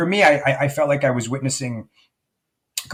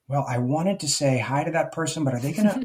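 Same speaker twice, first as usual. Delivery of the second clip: higher, worried self-talk, rising with each doubt; anxious inner voice; 7 semitones higher than usual. Retold or imitated. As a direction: fret aloud like an overthinking self. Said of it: "You don't have to check in and say".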